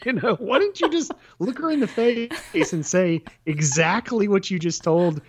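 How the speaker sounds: high, exasperated put-on voice; mock exasperated quote. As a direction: act out the obvious thing she should have said. Mocking what she should have said.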